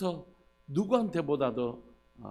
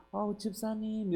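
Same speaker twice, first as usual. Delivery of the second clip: high, drawn-out, softer sweet greeting; put-on sweet voice, pitched up and slow; affectionate phone greeting; 8 semitones higher, 4 dB quieter, slower than usual. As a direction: coo it sweetly, imitating a fond phone greeting. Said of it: "너무 다정하게 얘기하는데".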